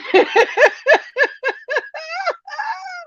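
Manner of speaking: a high, helpless burst of laughter. Real, not acted. Host laughs at a viewer's troll name, high helpless laughter. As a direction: crack up at the comment.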